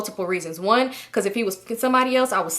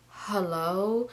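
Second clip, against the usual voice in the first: wary hello; 2 semitones lower, 9 dB quieter than usual. Retold or imitated. Calling him back, a guarded quiet hello. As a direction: answer quietly, holding back. Acting out a wary phone answer.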